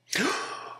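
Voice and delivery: shocked, high intake of breath; flabbergasted gasp. Acted. Priest reacting to Napoleon's confession, flabbergasted gasp. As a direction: gasp as if utterly floored. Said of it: "absolutely flabbergasted".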